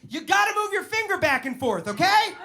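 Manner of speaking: very high, loud mom voice; mock mom. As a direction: play the mom giving blunt advice. Mom's imagined advice.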